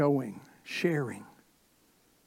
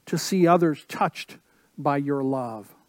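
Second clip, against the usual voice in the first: ordinary talk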